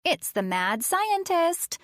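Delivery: put-on high, slow playful spooky child's voice; a little girl teasing in a silly voice; funny voice. Addie warning John in a funny voice. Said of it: "she said in a funny voice".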